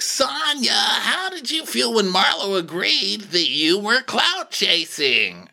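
high-pitched, drawn-on host questioning; imitated reunion host. Andy asking Sonya about clout chasing, mock host voice. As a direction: ham up the host's pointed question.

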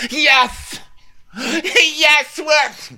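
very high, loud whoops; mock ecstatic yells. Imitating Rinna dancing on Instagram, mock ecstatic yells. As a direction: burst into hammed-up high cries.

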